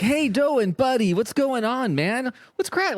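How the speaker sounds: very high, goofy hail; chummy high-pitched mock greeting; breezy comic greeting. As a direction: break the tension with an exaggerated hello. Acts breezy interruption to lighten mood.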